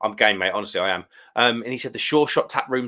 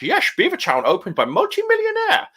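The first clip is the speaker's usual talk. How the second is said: a high, jokey Dutch slur; reading a chat line in put-on Dutch, hammed up; mock Dutch accent; 4 dB louder than usual. Reading a viewer's comment aloud in a mock Dutch accent. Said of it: "He's, he's gone all Dutch".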